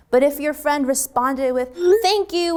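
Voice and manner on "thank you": bright, much higher "Thank you"; excited thanks. Shown as a demonstration. Teacher shows an overjoyed text reply, high and gushing. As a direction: gush "Thank you" like a million exclamation marks. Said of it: "a million exclamation marks and emojis".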